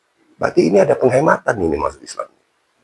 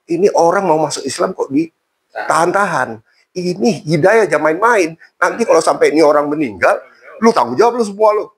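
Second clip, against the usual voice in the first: scolding Habib; 7 semitones higher than usual. Retold or imitated. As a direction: scold them like an angry cleric. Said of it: "Diomelin mereka semua".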